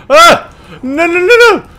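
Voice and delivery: high panicked screams of no; panicked screaming. Real, not acted. Player panics at a threat.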